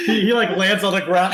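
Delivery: high, loud, giggly telling; laughing while talking. Real, not acted. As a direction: get it out through laughter. Laughing at the narration.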